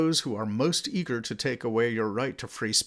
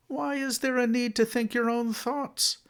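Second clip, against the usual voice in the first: much higher, sneering quote of a thought controller; mocking censor; 10 semitones higher than usual. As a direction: put on a lofty censor voice lecturing heretics. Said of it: "the heretics are told".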